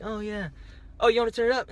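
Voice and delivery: high, chipper fake-happy voice; put-on cheerfulness. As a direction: play it off brightly, as if you don't care. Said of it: "trying to act happy".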